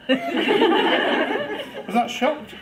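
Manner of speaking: high, squeaky mock shock; shocked face. Shown as a demonstration. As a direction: show a ten out of ten shock. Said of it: "Shall I show you? ... whether you think I look shocked".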